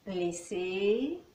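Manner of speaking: usual speaking voice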